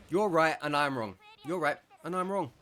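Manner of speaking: loud, snarky mock concession; sarcastic repeat. Retelling the man's sarcastic words at the gate, snarky. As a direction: sneer it back at him. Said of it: "he was being snarky and sarcastic".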